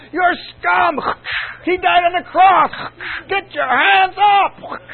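roaring, slow drill-sergeant shout; hammed-up shouting worship leader; mock slave-driver yell. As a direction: bellow at them like a slave driver. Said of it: "I was out there going".

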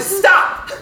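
high, loud, comic "Stop!"; pleading shout. Retold or imitated. Guest under relentless questions, exasperated shout. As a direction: cry out for mercy.

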